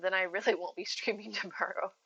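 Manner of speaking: ordinary talk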